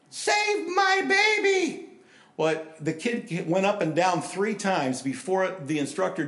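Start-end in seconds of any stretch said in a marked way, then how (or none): 0.1-1.9 high, slow, desperate wail of a mother; frantic mother's scream. Retold or imitated. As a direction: scream it like a panicked mother in the stands.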